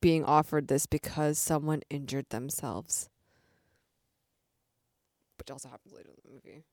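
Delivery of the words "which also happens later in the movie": very quiet, quick mumble; muttered aside. Real, not acted. Co-host drops a spoiler under her breath, muttered aside. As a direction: slip it in almost inaudibly, fast.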